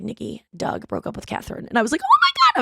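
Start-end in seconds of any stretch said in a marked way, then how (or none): 0.0-1.7 hushed conspiratorial whisper. Retold or imitated. Friend sharing secret news. 2.0-2.6 high, loud thrilled shriek; excited squeal. Retold or imitated. Thrilled reaction to news.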